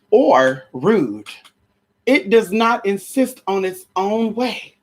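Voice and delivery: slow, solemn Bible reading; reading First Corinthians to the congregation, solemn; scripture reading. Acted. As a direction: savor each line of the verse. Reads Corinthians slowly.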